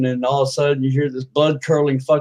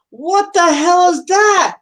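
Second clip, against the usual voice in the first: high, loud, drawn-out outcry; shocked yell; 5 dB louder, slower than usual. Acted. Customer being whacked in the face, shocked yell. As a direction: holler it in alarm.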